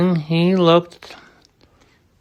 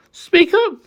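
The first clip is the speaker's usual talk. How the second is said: higher, calling to a tiny voice; a raised call, higher; 13 semitones higher than usual. A narrator's reading. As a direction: call up to someone far off. Horton calling to speck.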